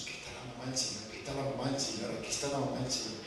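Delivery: quick hushed relay, low and repeated; rapid, low murmur of a message passed along. Retold or imitated. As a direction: rattle it off quietly like word spreading through a crowd. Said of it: "de voz en voz".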